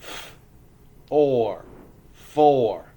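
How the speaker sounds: "four" split into a breath of f and a loud or, then blended; sounding out. Shown as a demonstration. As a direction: phonics drill on "four", sound by sound. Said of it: "Roller coasters, ready?".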